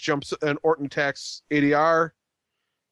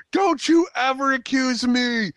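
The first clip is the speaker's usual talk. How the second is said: higher, slower, hammed-up tearful wailing; mock weepy high voice; 8 semitones higher, slower than usual. Natalya accused in the ring, mock weeping in a high voice. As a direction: overact a sudden breakdown in tears. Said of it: "In that voice".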